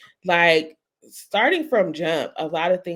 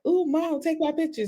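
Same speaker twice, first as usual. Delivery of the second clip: put-on high-pitched child asking for a photo; excited kid voice; 10 semitones higher than usual. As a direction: squeak it like an eager little boy. Child's excited request retold.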